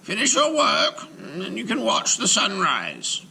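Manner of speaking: high, loud, fond instruction; kindly rabbi. Acted. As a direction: indulge the childlike giant. Rabbi gently tells golem.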